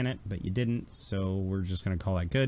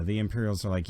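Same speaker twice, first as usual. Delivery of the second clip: usual speaking voice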